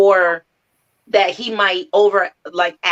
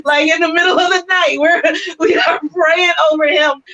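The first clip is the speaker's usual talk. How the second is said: laughing; 9 semitones higher than usual. Real, not acted. Laughing about midnight prayers.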